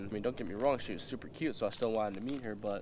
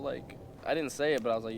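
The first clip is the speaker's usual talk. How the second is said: ordinary talk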